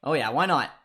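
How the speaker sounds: ordinary delivery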